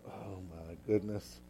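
slow, soft, low dismayed sigh; a father giving up on it, under his breath; dismayed dad. Retold or imitated. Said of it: "Dad was just kinda like".

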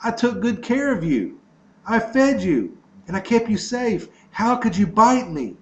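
a betrayed man who can't believe it; stunned disbelief. A narrator's reading. Dying man asking the snake he nursed, in stunned disbelief. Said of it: "he looked at the snake with complete disbelief".

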